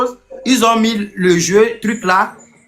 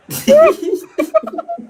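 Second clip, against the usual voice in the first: high burst of laughter. Real, not acted. Laughs out loud mid-argument. (éclat de rire aigu et soudain)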